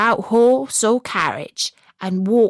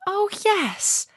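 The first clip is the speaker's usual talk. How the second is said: put-on high eager girl voice; eager recognition; 12 semitones higher, 3 dB quieter than usual. A narrator's reading. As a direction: leap at the news that it was him. Gerda recognizing Kay eagerly.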